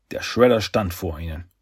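ordinary delivery, nothing marked

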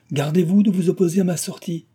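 high, loud and shaking with rage; mother ordering her son aside in violent agitation. Acted. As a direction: warn him off, barely holding together. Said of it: "l'agitation la plus violente".

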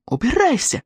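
high-pitched cry to get out; panicked order. A narrator's reading. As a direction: cry the order out high, without added volume. Girl orders intruder away, shielded.

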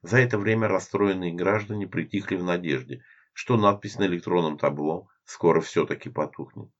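usual speaking voice

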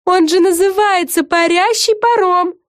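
high, drawn-out group-style shout. A narrator's reading. Everyone answers Vega in chorus, shouting. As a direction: call it out slowly and high. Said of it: "хором крикнули все".

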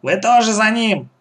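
high-pitched bossy order; commanding. A narrator's reading. Woman sends the children after her son, commanding. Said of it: "приказала женщина".

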